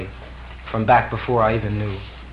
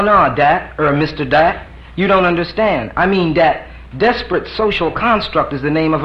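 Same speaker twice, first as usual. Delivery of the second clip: higher, louder pleading; insistent protest; 6 semitones higher, 5 dB louder than usual. Acted. D insisting to Pliny, louder protest. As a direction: push back hard. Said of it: "D insists".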